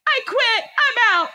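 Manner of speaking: high, emphatic walk-out declaration; retold outburst. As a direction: act out storming off. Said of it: "so I was like".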